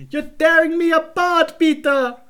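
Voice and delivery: put-on high, slow, overwrought Tommy Wiseau accent; Tommy Wiseau's strained accent, dragged out slowly; Tommy Wiseau impression. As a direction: wail it like a melodramatic Tommy Wiseau. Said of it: "I don't know why the accent went like".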